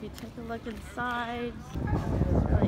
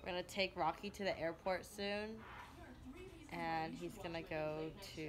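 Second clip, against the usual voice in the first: quiet; 3 semitones lower, 11 dB quieter than usual. Real, not acted. Lying in bed tired.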